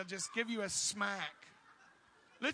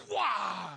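loud startled wah; mock startled yelp; 6 dB louder than usual. Preacher acting the angel's smack landing, loud yelp. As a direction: blurt a sudden jolted cry.

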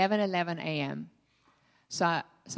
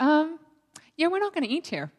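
awkward refusal; 9 semitones higher, 5 dB louder than usual. Retold or imitated. Telling mom they will leave.

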